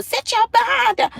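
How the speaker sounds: put-on high, scolding bark at a kid; mock parent yell. Imitating other parents yelling at their kids, mock yell. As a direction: play the harsh parent snapping at a child. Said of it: "curse at their kids and yell".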